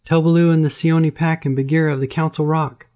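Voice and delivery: put-on high, loud boy's call; a cub yelling his message up to the sky; Mowgli. Mowgli calling to Ron from the treetops, high urgent boy.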